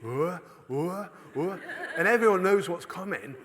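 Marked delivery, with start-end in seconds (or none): 0.0-1.6 repeated rising gasps before a sneeze; mimicked pre-sneeze intakes; imitated sneeze build-up. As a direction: act out a sneeze slowly winding up. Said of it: "it would build up over a minute".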